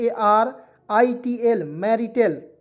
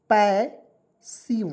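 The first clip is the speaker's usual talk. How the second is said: Passive very slow, with a long gap inside; syllable by syllable; much slower than usual. Shown as a demonstration. Teacher showing learners how to read it, syllable by syllable. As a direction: stretch passive into two separate syllables.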